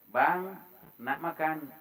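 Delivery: soft, considerate wifely question; good, polite intonation. Shown as a demonstration. Wife asks husband if he wants food, gently. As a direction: ask gently, like a caring wife. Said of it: "baik intonasinya".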